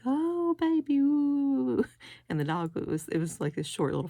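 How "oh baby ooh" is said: very high, loud, drawn-out coo at a puppy. Real, not acted. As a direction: melt over the little dog, gushing. Cooing over a cute dog.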